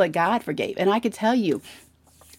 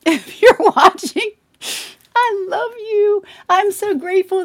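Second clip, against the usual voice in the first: tearful; 11 semitones higher, 4 dB louder than usual. Real, not acted. Speaking to her mother, voice breaking with real tears. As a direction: voice breaking, high and slow. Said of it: "I wanna say to Mom".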